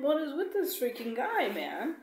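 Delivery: high-pitched, loud disbelief at the guy; annoyed rant. Retold or imitated. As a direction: vent your irritation. Ranting about rude passenger.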